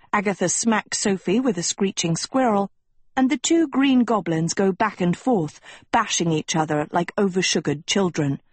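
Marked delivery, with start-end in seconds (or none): none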